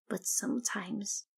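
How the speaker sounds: hushed whisper, secretive; whispered warning. Acted. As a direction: lean in and whisper it. Ditzy warns of hidden creatures.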